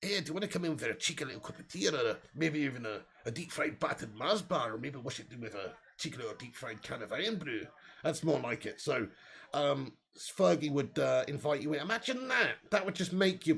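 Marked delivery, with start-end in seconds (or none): 0.0-7.7 a put-on Scottish accent, fast and softer; hammed-up Scottish offer of tea; Sir Alex in Scottish. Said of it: "I meant to say".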